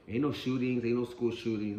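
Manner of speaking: normal talking voice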